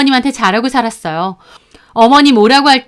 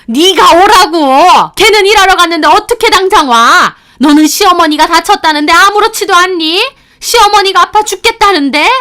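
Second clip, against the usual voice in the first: furious mother-in-law; 7 semitones higher, 7 dB louder than usual. Retold or imitated. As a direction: rant at her daughter-in-law. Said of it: "하면서 펄쩍펄쩍 뛰시더라고요". (펄쩍 뛰며 며느리에게 소리치는 시어머니, 높고 큰 고함)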